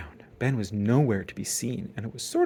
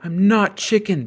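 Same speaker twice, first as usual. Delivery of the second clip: protesting; 8 semitones higher, 6 dB louder than usual. A narrator's reading. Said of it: "protested Brother".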